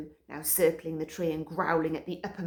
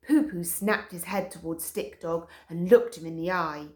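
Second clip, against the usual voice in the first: ordinary delivery, nothing marked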